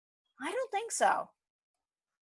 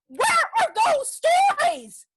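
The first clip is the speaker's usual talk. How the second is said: high, loud, drawn-out cry; impassioned shouted demand; 7 dB louder, slower than usual. Acted. Woman demanding untold stories, shouting at the peak. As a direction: shout it slowly, hammering every word.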